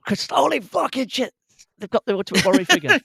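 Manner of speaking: high, fast excited imitation; imitating excited Chris. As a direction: mimic a friend losing it over a find. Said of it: "and we found this".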